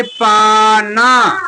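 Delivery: "पाना" sounded out very slowly; drawn-out reading. Shown as a demonstration. Teacher blending पा and ना for pupils, slowly. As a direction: stretch each syllable of "पाना". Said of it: "मिला के क्या पढ़ेंगे".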